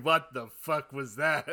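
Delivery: laughing mock-mogul disbelief; Robert Loggia outrage. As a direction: bark it like the furious mogul, laughing. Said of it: "So you were Robert Loggia going".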